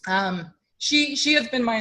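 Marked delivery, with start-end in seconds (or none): none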